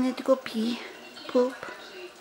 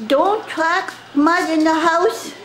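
higher, louder, slow scolding mother voice; mock stern mom; 6 semitones higher, 6 dB louder, slower than usual. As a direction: imitate mom's slow scolding, hammed-up. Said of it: "and Mommy said".

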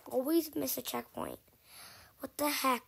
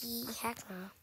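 whispered refusal; 8 dB quieter than usual. Real, not acted. Refusing a scary stage.

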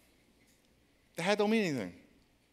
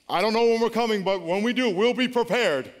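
louder, higher mock-serious boast; cocky kid; 5 semitones higher, 5 dB louder than usual. Oldest boy warns the neighbor, mock-serious boast. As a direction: sound like a little tough guy.

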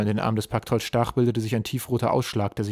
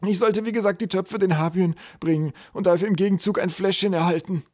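high, loud character voice; babbling troll; 9 semitones higher, 5 dB louder than usual. A narrator's reading. Troll explains his errand to the general, babbling. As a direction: prattle out the errand anxiously. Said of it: "plapperte Schlackernot drauf los".

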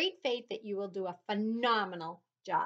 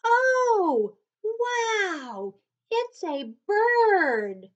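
high, loud amazed oh wow; shouted; 11 semitones higher, 10 dB louder, much slower than usual. A narrator's reading. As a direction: shout with wonder. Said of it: "he shouted".

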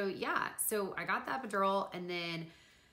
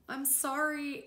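small, high-pitched whimpered apology; mock tearful apology; 9 semitones higher, slower than usual. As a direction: replay a pitiful apology, pitched well up. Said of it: "And I was like".

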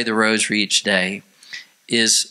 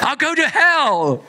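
mock horror; 13 semitones higher, slower than usual. Acted horror at the request.

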